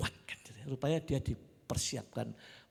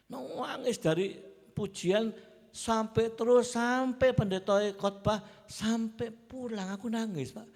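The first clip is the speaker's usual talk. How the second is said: overwhelmed; 9 semitones higher, 6 dB louder, slower than usual. Retold or imitated. Overcome by tears in church.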